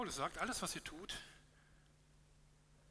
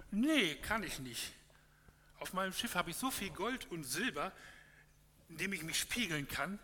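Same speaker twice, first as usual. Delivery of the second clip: louder, higher, slow haughty refusal; boastful vanity; 5 semitones higher, 8 dB louder than usual. Acted. Vanity boasting to Love about her gold and silver, haughty loud refusal. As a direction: puff yourself up like vain Vanity showing off gold.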